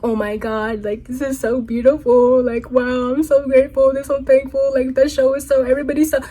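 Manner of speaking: reliving being moved to tears by a show; moved gushing. Retold or imitated. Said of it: "had me so moved that I was like".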